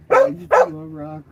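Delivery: high, loud puppy voice; dog's voice. Retold or imitated. Owner voicing the dog's love of rocks. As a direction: gush like an excited puppy. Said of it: "You're like".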